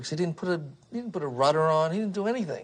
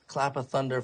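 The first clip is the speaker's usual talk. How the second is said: usual speaking voice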